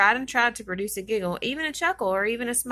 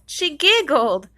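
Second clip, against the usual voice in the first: higher, louder, slow, laughing through the words; big giggle; 6 semitones higher, 9 dB louder, much slower than usual. Acted. Narrator laughing aloud at the happy ending. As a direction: let the giggle burst out.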